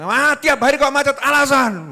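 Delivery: high, loud nagging; furious wife berating her husband for coming home late; angry scolding wife. Acted.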